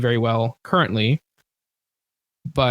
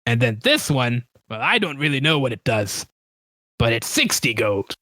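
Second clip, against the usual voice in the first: lightly put-on seller's voice; a hint of a character shopkeeper. Game master as shopkeeper quotes a price, lightly put-on voice. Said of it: "trying to find this guy's voice".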